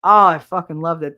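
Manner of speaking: ordinary delivery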